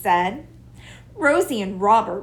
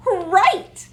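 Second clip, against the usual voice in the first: higher, louder eager cry; eager shout; 10 semitones higher, 6 dB louder than usual. A narrator's reading. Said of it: "called Rosie".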